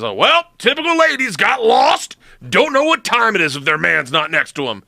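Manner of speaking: high hammed-up condescending voice; mock misogynist. Military guy mocking the wives as a staged prank, high and condescending. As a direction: play the sneering chauvinist for a prank. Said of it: "act a little, like, aggressive to them and misogynistic".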